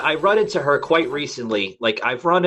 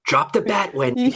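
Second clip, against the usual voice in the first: higher-pitched movie-villain impression; pitched-up menacing film impression; Jack Nicholson; 10 semitones higher than usual. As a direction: play the Shining husband taunting his wife, pitched up. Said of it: "Jack Nicholson from The Shining".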